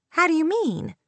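high-pitched, insistent question; demanding, mildly angry. A narrator's reading. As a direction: demand an explanation with a touch of anger. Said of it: "Kate demanded, already angry with Luke".

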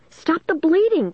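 high-pitched frantic cry; hysterical, pitched far up; screaming. A narrator's reading. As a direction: scream it in panic. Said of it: "Caitlin screamed".

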